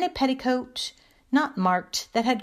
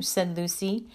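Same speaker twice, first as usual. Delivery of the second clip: ordinary delivery, nothing marked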